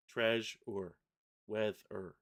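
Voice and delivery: "Treasure" as treas, ure and "Weather" as weath, er; split into syllables. Shown as a demonstration. Showing the morpheme rule, "Treasure" and "Weather" broken in two. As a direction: pause inside "Treasure" and "Weather", after the middle consonant. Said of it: "it's that morpheme rule".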